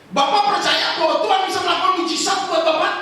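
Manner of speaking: high, loud pointed question. Retold or imitated. Pastor confronting a churchgoer, loud demanding question. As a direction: press him hard on where Jesus is.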